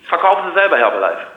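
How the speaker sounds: usual speaking voice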